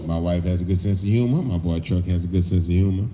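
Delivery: ordinary talk, nothing marked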